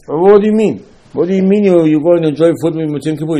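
loud, high challenge to the congregation; indignant outburst. Acted. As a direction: throw the question at them in mock outrage. Rebukes people who feast after fasting.

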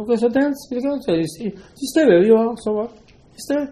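high-pitched, mock-exasperated dismissal; acted so-what retort, high and quick to dismiss; dismissive retort. Acted scolding of reluctant people.

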